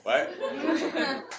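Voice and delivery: high, stunned laughing what; a father caught off guard; mock disbelief, laughing; shocked what. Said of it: "I was like".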